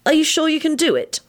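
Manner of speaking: high, worried question; anxious girl's voice. A narrator's reading. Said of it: "Elizabeth said anxiously".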